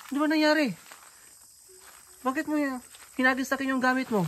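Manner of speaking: calling out. Real, not acted. Calling after fleeing woman.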